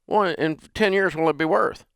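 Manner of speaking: a hint of a doubting grandpa; grandfather's voice. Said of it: "And he goes".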